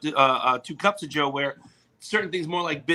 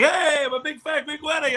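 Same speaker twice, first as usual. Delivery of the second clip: high-pitched mock shout of recognition; imitated fan holler; 11 semitones higher than usual. Drunk storyteller mimicking a guy spotting him, hollering. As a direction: call out like an excited passer-by.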